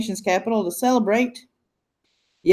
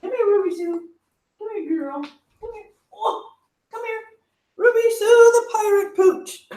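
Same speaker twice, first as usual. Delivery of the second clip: puppy-calling voice; 11 semitones higher than usual. Real, not acted. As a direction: coax her over in a high voice. Coaxing the puppy to camera.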